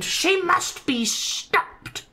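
very high, slow shrieking prince; squeaky villain prince screaming; screamed line. Acted. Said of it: "ran right behind her and screamed".

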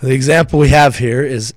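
ordinary delivery

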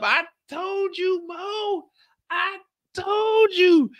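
high, slow, drawn-out I told you; high sing-song told-you-so from the wife; mock wife gloating. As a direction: play the wife rubbing it in, high and stretched. Wife Kendall voiced gloating.

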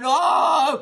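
loud, high mock wail; drawn-out crocodile-tears "oh"; imitated crying wail. Child crying for Mama to get his way, mock wail. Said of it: "The child is crying".

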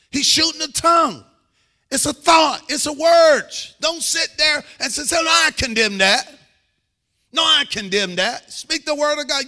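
fired-up preacher at full voice; full-force preaching. Real, not acted. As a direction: preach it hard to the congregation, punching the key words.

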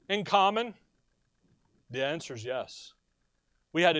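normal talking voice

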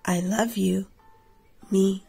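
ordinary delivery

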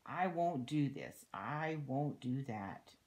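emphatic storybook refusal, slowing at the end; Annabelle Pratt. A narrator's reading. Voicing a naughty child refusing everything, emphatic refusal. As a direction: refuse each thing firmly, as if reading a child's line. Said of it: "says young Annabelle Pratt".

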